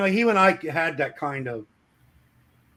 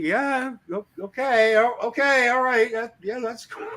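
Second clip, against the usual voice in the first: nervous stammer; 8 semitones higher than usual. Retold or imitated. Coworker intimidated, stammering.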